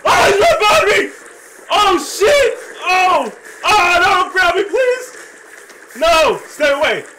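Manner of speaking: screaming in high panic; panicking gamer. Real, not acted. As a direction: shriek for help, very high and loud. Chased in horror game.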